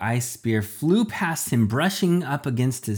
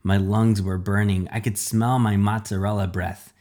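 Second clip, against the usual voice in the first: usual speaking voice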